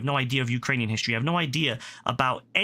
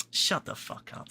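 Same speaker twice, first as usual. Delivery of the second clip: quiet; 6 dB quieter than usual. Real, not acted. Cutting off own mock quote.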